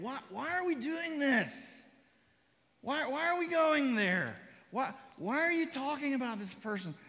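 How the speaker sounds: high, pleading divine voice; grieving Holy Spirit. Acted. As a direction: plead gently as the hurting Spirit. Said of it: "the Holy Spirit is saying".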